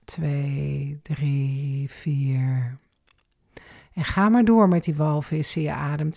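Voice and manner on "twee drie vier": exhale count. Shown as a demonstration. Instructor counting the out-breath, low and soft. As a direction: lower and softer, letting it sink. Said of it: "En uit".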